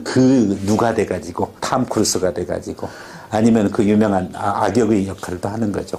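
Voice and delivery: ordinary talk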